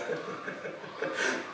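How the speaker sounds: a breathy, secretive chuckle; sly murderer's quiet chuckle; soft chuckle. Acted. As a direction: chuckle under the breath at the idea. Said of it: "I fairly chuckled at the idea".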